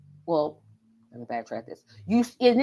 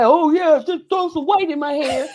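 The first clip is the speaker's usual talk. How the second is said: mock request; 6 semitones higher, 5 dB louder than usual. Mocking imagined client request.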